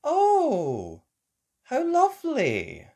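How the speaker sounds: high, bright delighted voice; delighted Mummy. A narrator's reading. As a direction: gush happily.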